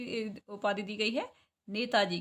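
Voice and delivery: normal talking voice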